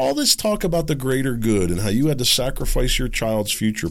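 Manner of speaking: ordinary talk, nothing marked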